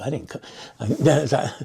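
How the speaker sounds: ordinary talk, nothing marked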